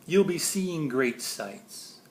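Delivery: usual speaking voice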